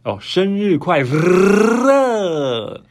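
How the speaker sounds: "生日快乐" with a rolled r. Shown as a demonstration. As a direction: roll the r through "生日快乐". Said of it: "你要讲，讲生日快乐".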